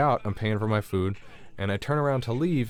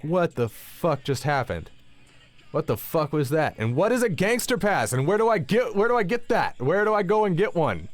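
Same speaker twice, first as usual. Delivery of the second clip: rant, baffled, climbing higher each question; incredulous rant; 9 semitones higher than usual. Retold or imitated. Storyteller replaying his baffled reaction after the police left, rising disbelief.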